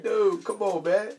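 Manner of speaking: high, pleading exasperation; exasperated plea. Retold or imitated. Telling his friend to stop, high exasperated plea. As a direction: scold the friend in mock despair.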